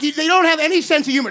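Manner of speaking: normal talking voice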